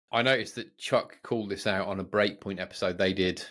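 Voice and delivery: ordinary delivery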